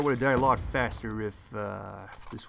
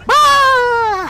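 sharp high cry; 13 dB louder than usual. Real, not acted. Laughing scream at his joke.

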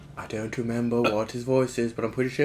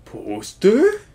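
loud dramatic voice; 8 semitones higher, 9 dB louder than usual. Acted. Voicing Bowser loudly.

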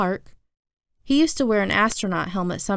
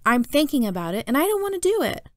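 rising high into refusal; protesting kid; 6 semitones higher than usual. A narrator's reading. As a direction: push back at mom, pitch climbing. Kid protesting to his mother.

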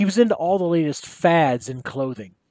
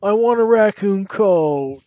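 higher, louder, slow wail; 6 semitones higher, 4 dB louder, slower than usual. Acted. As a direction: wail it like a whining boy. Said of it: "he wailed".

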